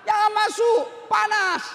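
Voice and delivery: high, loud panicked shout; high-pitched alarm shout; warning yell. Retold or imitated. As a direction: yell a frantic warning across the room. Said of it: "Dia mau teriak".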